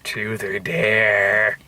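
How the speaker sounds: low, very slow menace; imitating a creepy horror-trailer line. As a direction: draw it out low and eerie.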